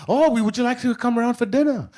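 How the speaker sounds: high, eager American host; eager townsperson. Retold or imitated. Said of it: "when they call him on the phone".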